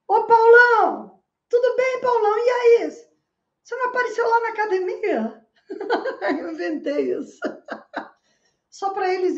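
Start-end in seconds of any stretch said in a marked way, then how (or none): 0.1-5.4 loud, high call to a big friend nearby. Retold or imitated. Speaker calling out to a made-up friend to scare off two muggers, loud and high. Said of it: "e falei". 5.7-8.1 laughing. Real, not acted. Laughing at own trick.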